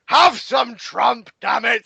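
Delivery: loud, very slow mock shout; boss shout. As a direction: bellow the announcement like a brash boss. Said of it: "he's like".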